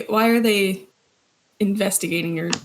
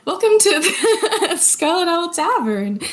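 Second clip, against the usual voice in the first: laughing; 8 semitones higher than usual. Acted. Greeting after correcting, laughing.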